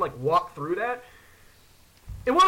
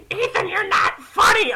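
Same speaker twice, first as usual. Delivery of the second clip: high, loud, drawn-out mock wail; whiny scolding; 12 semitones higher, 5 dB louder than usual. [name] scolding Ethan in the cornfield, whiny wail. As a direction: wail it like a scared, annoyed friend.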